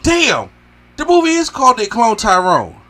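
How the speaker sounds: high, loud stunned outburst; stunned realization. Retold or imitated. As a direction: blurt it out in shock. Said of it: "I was like".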